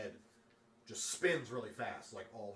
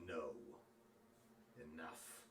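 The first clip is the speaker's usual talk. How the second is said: low, much softer, firm and final; quiet defiance; 7 semitones lower, 12 dB quieter than usual. Acted. Prisoner to the dragon, quiet firm defiance. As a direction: stop the dragon with a soft command.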